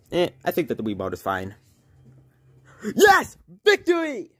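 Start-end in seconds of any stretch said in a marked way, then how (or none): 2.9-4.3 triumphant cheer. Real, not acted. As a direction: crow over the win. Winning the race.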